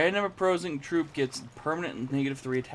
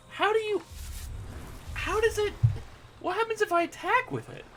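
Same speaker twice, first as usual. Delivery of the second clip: baffled disbelief. Real, not acted. Baffled by strange card.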